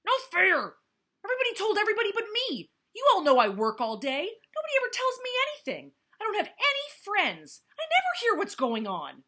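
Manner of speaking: put-on high, loud childish yell. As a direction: rant it out loud like a tantrum. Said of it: "then he gnashed his teeth and yelled".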